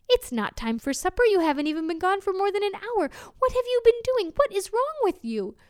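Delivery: wife scolding her husband for coming home early. Acted. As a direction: nag him harder with every question.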